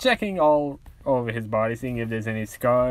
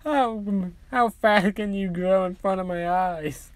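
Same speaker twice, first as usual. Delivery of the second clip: much higher giddy mother gushing; happy and giddy; 6 semitones higher than usual. Acted. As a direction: coo over her boy like a doting mom. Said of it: "all happy and giddy".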